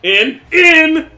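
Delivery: high, loud yelp; shrieked celebration; excited cry. Acted. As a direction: shout it way up high, stretching the last word. Excited cry in acted ball bit.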